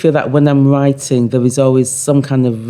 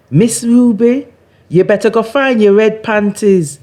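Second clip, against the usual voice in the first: higher-pitched cheeky patois jibe; teasing neighbour; 10 semitones higher, 4 dB louder than usual. Acted. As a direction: poke fun at Miss Ruby. Neighbour teases Miss Ruby.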